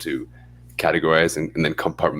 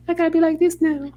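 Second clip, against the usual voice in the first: mock high voice. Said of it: "I probably do it here".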